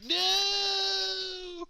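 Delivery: high anguished wail; dramatic cry. Retold or imitated. Imagining the broken Xbox, high anguished wail. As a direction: wail as you crumble.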